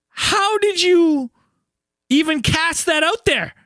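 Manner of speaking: high, drawn-out incredulous question; retold disbelief. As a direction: stretch out the acted astonishment. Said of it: "And I go".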